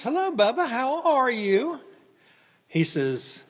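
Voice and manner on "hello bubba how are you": cheerful greeting. Retold or imitated. Greeting old worker on phone.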